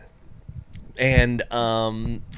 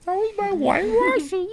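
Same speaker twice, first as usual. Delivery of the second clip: high squeak. Retold or imitated. Imitating her hiccup squeal.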